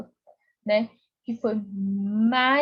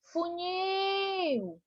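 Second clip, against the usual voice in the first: high, softer sing-out with a long held nil; calling out; 7 semitones higher, 6 dB quieter than usual. Shown as a demonstration. Teacher calling the word like someone's name, high drawn-out call. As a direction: call funil from afar, stretching the end. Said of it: "na hora que eu chamei".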